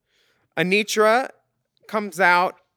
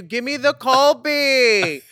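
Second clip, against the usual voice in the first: photographer's call; 7 semitones higher, slower than usual. Retold or imitated. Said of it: "a Videla yells at you".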